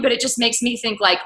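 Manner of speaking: normal talking voice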